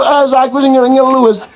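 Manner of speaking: ordinary talk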